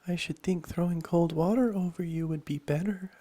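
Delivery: put-on high, loud girl character; Alice's voice. A narrator's reading. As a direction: pitch it up, suggesting as young Alice.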